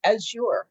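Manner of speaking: AZURE said carefully, stretched out in two clear parts; North American pronunciation. Shown as a demonstration. Crafter shows viewers how we say it, AZURE pronounced carefully. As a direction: say Azure slowly, as AZH-yur. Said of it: "we say Azure".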